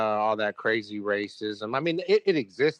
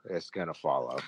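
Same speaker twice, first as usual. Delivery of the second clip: softer, lower repeat trailing off; quiet echo; 8 semitones lower, 7 dB quieter than usual. Real, not acted. Podcast host echoing his own prediction to his co-host, quiet and low. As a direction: let it drop to a grim murmur.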